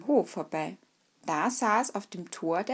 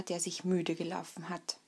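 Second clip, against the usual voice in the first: usual speaking voice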